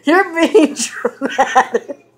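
slow, broken up by real laughter; laughing protest. Real, not acted. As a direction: laugh helplessly through the words. Laughs off the friend's rant.

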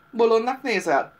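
loud scornful jeer; glaring, half laughing. A narrator's reading. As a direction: bark it back with a cackle in it. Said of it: "merett rá Dimu, kacagásszerű hangot hallatva".

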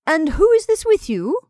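high, loud social inquiry; inquiring. A narrator's reading. Missus Townsend asks Perry about his companion, inquiring. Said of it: "she inquired".

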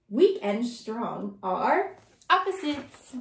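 plain reading voice

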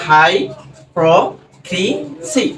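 হাই, প্র, ক্রি, সি with a pause after each; teacher's spaced sounding-out of হাই, প্র, ক্রি, সি; broken into syllables. Shown as a demonstration. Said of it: "এভাবে কিন্তু ভাঙা যায়".

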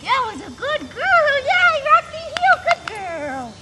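excited. Real, not acted. Excited praise of dog in training.

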